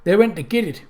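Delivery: put-on rustic old man with a nasal twang; lightly voiced country elder, nasal; old man's nasal twang. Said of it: "he said in a flat nasal twang".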